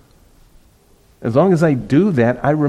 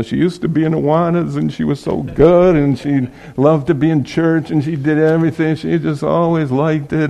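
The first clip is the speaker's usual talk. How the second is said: mock pleading, pitched higher and run on; pleading dad; 4 semitones higher than usual. Dad pleading to pastor about rebellious daughter, higher pleading voice. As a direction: lightly imitate a dad making his case. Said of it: "he's pleading with me".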